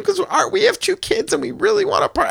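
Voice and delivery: high, sweet polite wife voice; all polite. Retold or imitated. Imitating his wife asking a parked driver, all polite. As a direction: knock and ask ever so politely, way up high. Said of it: "she was all polite".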